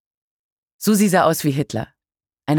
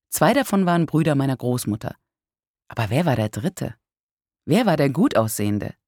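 ordinary delivery

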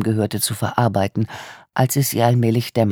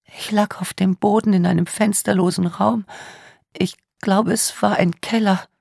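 put-on tearful young woman; 9 semitones higher than usual. A narrator's reading. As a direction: voice a traumatised young woman in tears. Tearful victim speaks.

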